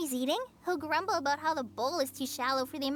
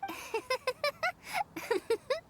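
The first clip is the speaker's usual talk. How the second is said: a bright, higher giggle; light laugh; 11 semitones higher than usual. Real, not acted. Collei laughs at Paimon and the Traveler's banter. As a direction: laugh along, amused.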